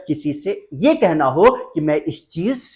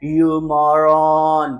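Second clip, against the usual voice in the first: drawn-out, stylish "you moron"; stylish insult; 5 dB louder, much slower than usual. Shown as a demonstration. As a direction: stretch "moron" out with showy flair. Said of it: "थोड़ा style में कमी मत करिएगा".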